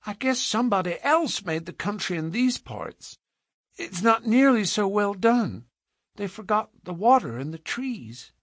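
put-on high piping child voice; small girl earnestly explaining; matter-of-fact little girl. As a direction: reason it out with childish certainty. Little girl's voice.

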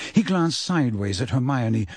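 plain reading voice

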